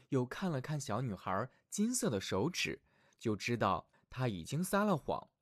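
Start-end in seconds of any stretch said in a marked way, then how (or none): none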